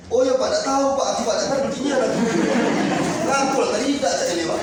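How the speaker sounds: lightly mocked bad answer; clueless staff reply. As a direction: play a helpless clerk dodging the question.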